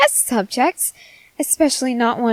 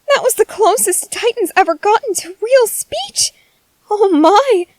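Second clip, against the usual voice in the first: thrilled; 8 semitones higher, 4 dB louder than usual. Acted. Scientist thrilled by Titan's word.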